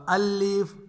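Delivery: الف with a doubled, held L; alif with shadda. Shown as a demonstration. Teacher shows trainees the error, L doubled. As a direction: wrongly double the لام in الف. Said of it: "یعنی شد کے ساتھ نہیں پڑھ".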